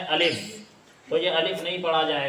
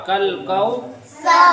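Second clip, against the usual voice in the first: कल Kausar loud, higher and slow, ending open on सर; no stop; 6 semitones higher, 8 dB louder, much slower than usual. Shown as a demonstration. Teacher shows reading without the pause. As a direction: carry Kausar through without stopping, louder and higher. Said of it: "अगर वक्फ ना करें तो क्या होगा?".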